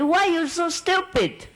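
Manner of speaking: high, slow, exasperated scolding; retold snap at him for being stupid; mock angry scolding. Wife scolds her husband's friend, mock angry and drawn out. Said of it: "Oh, I was so mad at him".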